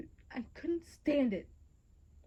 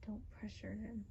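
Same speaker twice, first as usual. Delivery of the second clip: softly; 11 dB quieter than usual. Acted. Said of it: "I said to him".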